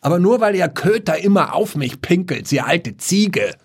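high, cross retort, slowing on Ziege; dead father insulting the old woman; scolding back. Acted. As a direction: snap back at the old neighbour. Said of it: "schimpfte Pauls Vater zurück".

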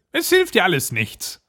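high-pitched witch, unpitying; dismissive old witch. Acted. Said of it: "sagte die Alte". (unbarmherzige alte Hexe)

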